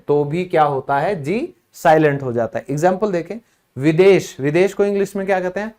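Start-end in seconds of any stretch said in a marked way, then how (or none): none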